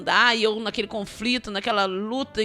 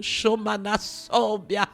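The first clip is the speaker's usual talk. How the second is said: speaking in tongues; much slower than usual. Real, not acted.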